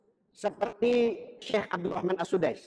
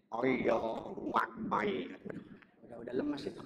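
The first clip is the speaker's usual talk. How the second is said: put-on weak, much softer old-man voice; feeble elderly imam; 2 semitones higher, 9 dB quieter than usual. Teacher mocks a seventy-year-old imam out of energy, feeble voice. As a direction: trail off like a worn-out old imam. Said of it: "Kalau yang imam usia tujuh puluh tahun".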